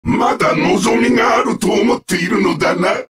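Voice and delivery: ordinary delivery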